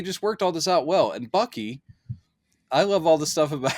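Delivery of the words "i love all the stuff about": usual speaking voice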